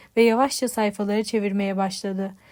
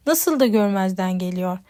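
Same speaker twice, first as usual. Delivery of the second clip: ordinary delivery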